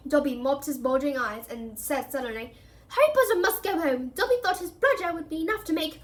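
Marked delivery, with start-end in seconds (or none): 2.9-6.0 high, pleading little elf; house elf voice. A narrator's reading. The elf reproaches Harry, high and pleading. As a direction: plead with the boy, higher.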